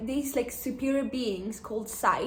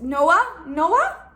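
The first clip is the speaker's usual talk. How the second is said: puzzled guessing; 6 semitones higher, 8 dB louder than usual. Real, not acted. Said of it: "What is it?".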